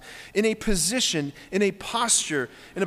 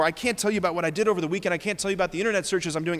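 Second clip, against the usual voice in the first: rushed confession; much faster than usual. Retold or imitated. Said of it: "we say to ourselves".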